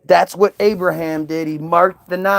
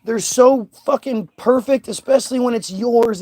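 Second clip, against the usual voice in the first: ordinary talk, nothing marked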